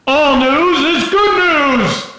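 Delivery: loud, high, slow proclamation; imitating a colleague's big booming voice. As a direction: boom it out to the whole room, stretching the words. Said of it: "in his booming voice".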